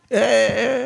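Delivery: high, loud "eh eh" cry; imitated cry of dismay on waking; mock wail. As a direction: act out a high yelp of self-reproach. Acts self-reproach after dream.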